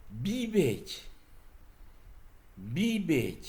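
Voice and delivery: "bibet" said high and clear, the first i drawn out; careful word model. Shown as a demonstration. Teacher models the first word "bibet" to students, high and clear. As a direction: pronounce "bibet" slowly for learners to repeat. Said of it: "Et le premier mot".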